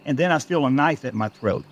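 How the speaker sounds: usual speaking voice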